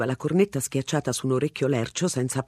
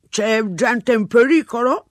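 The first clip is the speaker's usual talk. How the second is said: slow, louder chewing question; mouth full; 8 semitones higher, 7 dB louder, slower than usual. A narrator's reading. Policeman questioning Mr Ransom while chewing. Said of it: "stava masticando qualcosa".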